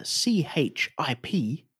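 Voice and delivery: slow letter by letter; spelling it out. Shown as a demonstration. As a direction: spell it out slowly. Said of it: "Can you spell it for me?".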